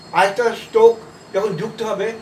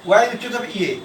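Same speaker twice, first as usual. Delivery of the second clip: ordinary delivery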